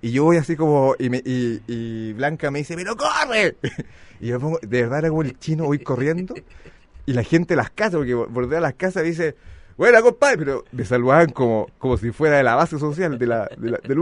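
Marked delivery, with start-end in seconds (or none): 2.8-3.5 urging shout. Retold or imitated. Blanca le grita que corra. 9.8-10.4 higher, louder cheery greeting from the onlookers. Retold or imitated. Gente saluda desde las casas.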